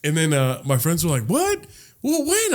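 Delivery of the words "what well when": high-pitched mock surprise; retold startled questions. Friends hearing the news, high startled questions. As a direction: ask way up high, taken aback.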